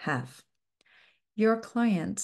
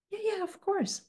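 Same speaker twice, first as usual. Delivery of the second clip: client agreeing; 12 semitones higher, 3 dB quieter than usual. Retold or imitated. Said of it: "client agreeing".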